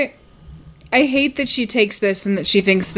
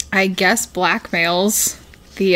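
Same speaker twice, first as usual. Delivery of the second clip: ordinary delivery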